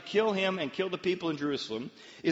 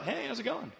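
high, casual singsong hello; breezy greeting; 9 semitones higher, 4 dB quieter than usual. Acted. Elisha greeting the soldiers hunting him, breezy. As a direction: chirp it as if nothing's wrong.

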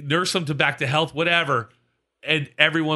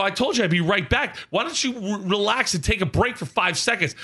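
higher-pitched exasperated telling-off; retold yelling; 6 semitones higher than usual. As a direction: re-enact scolding the heckler. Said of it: "I'm yelling at this guy".

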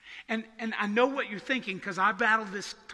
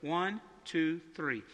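ordinary delivery

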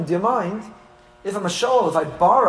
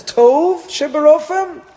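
very high, loud, slow drawn-out sneer; mocking pompous boast; 12 semitones higher, 8 dB louder, much slower than usual. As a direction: ham up the doctor's vanity, stretching tov. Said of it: "only when one says".

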